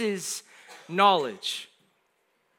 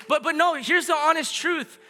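raised pitch, building through a list of phrases; impassioned preaching; 5 semitones higher, 4 dB louder than usual. Real, not acted. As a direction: preach it with insistent emphasis, stretching the key words.

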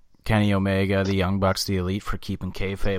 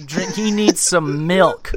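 slow and higher, mocking a whiny kid; mock baby talk; 7 semitones higher, 4 dB louder, much slower than usual. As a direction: treat him like a fussy baby, drawn out. Mocking Bowman.

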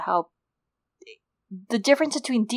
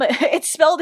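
usual speaking voice

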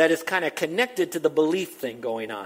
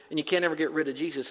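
ordinary delivery

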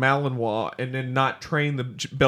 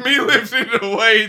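slow, laughing caveman voice; a laughing caveman echo; 7 semitones higher, 7 dB louder, slower than usual. Acted. Repeats caveman joke.

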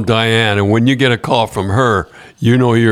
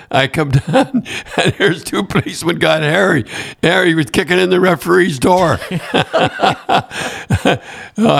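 higher voice, laughter breaking in; amused storytelling; 7 semitones higher than usual. Real, not acted. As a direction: tell it on the edge of laughing. Laughing as he tells the anecdote.

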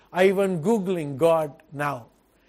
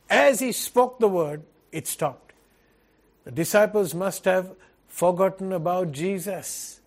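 ordinary talk

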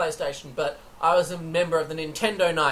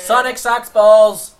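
loud; 3 semitones higher, 13 dB louder, slower than usual. Acted. Mocking rant about Sega.

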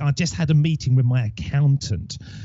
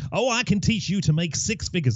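imitating a slick American guru in an American accent; American accent, salesy pitch; playful American accent; mock American accent; 6 semitones higher than usual. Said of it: "I don't know why I got an American accent".

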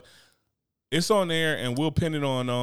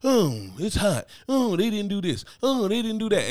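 lightly mocking whiny voice, pitched up; whiny complainer; 7 semitones higher than usual. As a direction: imitate a moaning coworker, a bit higher.

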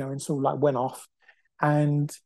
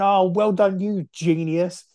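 raised, higher mock shout, dragging out the last words; retold sarcastic shout; 4 semitones higher, slower than usual. Man on crutches shouting back at rude driver, mildly raised. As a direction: re-enact calling out a mocking congratulation. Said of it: "I shouted and went".

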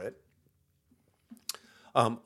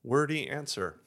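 ordinary delivery

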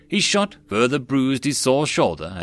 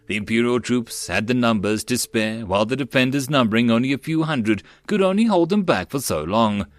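plain reading voice

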